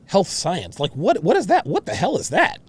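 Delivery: ordinary talk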